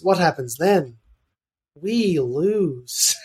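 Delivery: put-on a light Scottish accent, dry and matter-of-fact; deadpan Scottish delivery of "We lose". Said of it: "he's very Scottish".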